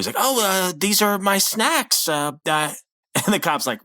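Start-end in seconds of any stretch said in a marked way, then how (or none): 0.2-2.1 high, hesitant, drawn-out fake innocence; trying to cover. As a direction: imitate someone improvising an excuse. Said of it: "he's trying to cover".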